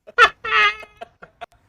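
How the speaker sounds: loud high cackling laugh; howling with laughter; shrieking laugh. Real, not acted. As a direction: burst out laughing hard. Laughing at punchline.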